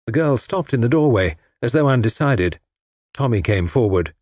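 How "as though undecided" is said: plain reading voice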